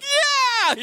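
very high, long triumphant shriek; piercing high-pitched squeal of victory; schoolgirl scream. Retold or imitated. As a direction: scream "yeah" like a little schoolgirl. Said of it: "screamed like a little schoolgirl".

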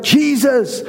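high, pleading call from a distance, mildly performed; crying out. Acted. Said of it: "lifted up their voices, and cried out".

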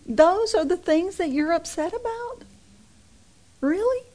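imagined exasperated aside to the congregation; exasperated disbelief. Pretend. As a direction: throw up your hands in disbelief. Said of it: "look at people and say".

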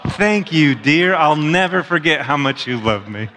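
sing-song mock sweetness; a hint of a sly child mocking; kid's sarcastic voice. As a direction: imitate a cheeky kid thanking mom. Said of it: "he's like".

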